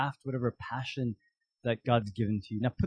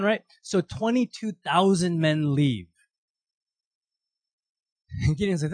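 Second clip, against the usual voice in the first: usual speaking voice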